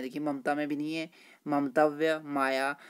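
ordinary talk

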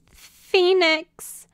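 high, loud falling wail; whimpering. Acted. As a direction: call out tearfully, pleading. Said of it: "Quixotic's voice whimpered".

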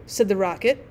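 plain reading voice

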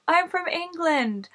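high, slow mock-child answer; imitated little kid. Six-year-old answering the trainer, imitated high and drawn out. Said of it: "I should have been like".

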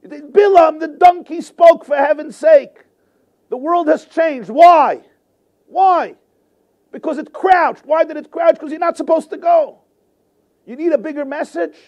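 high, loud exasperated preaching; exasperated shout. Acted. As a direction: yell at him to wake up.

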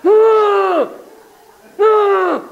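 put-on high, shouted kid's wail breaking into cries; loud high tween meltdown; whiny kid. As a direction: wail like a ten-year-old cheated. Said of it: "around age eight, nine, ten".

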